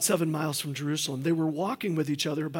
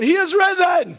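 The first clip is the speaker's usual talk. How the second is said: much higher, louder Easter call; bigger shout; 14 semitones higher, 12 dB louder than usual. Real, not acted. Pastor leading the second Easter call, louder shout. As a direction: go even bigger this time. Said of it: "let's do it again".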